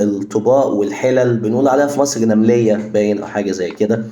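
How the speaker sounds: usual speaking voice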